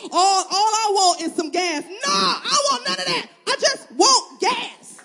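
high, loud, half-laughing whine; exasperated whine. Pretend. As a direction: whine like a fed-up customer who only wants gas. Exasperated customer, laughing, whiny.

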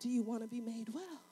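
soft, low, tender question; Jesus quietly asking the sick man; gentle Jesus. Acted. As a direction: ask it gently, almost under breath. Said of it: "And Jesus says".

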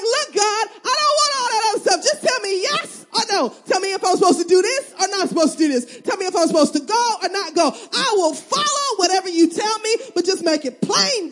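high, loud, half-crying plea to God; mock desperate plea. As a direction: beg God like you are at the end of your rope. Desperate prayer acted out.

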